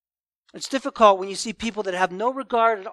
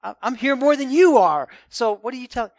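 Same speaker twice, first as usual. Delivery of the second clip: defensive surprise; 4 semitones higher, 5 dB louder than usual. Retold or imitated. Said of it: "They kind of act surprised and kind of push back".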